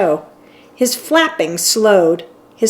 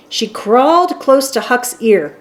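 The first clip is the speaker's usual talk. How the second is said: ordinary delivery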